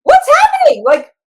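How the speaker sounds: high, loud panicked squeal of disbelief; shocked shriek. Retold or imitated. Managers panicking at the news, shrieking in disbelief. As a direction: shriek like the plan just blew up. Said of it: "my managers just about have a stroke".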